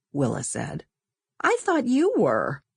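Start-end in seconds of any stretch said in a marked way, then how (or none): 1.4-2.6 put-on higher girl's voice, a bit put out; Sonia indignant. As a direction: protest like an eleven-year-old. Girls arguing over who asks.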